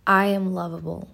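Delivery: clear, slow affirmation; spoken affirmation. Shown as a demonstration. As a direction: state it with conviction. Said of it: "Say it with me".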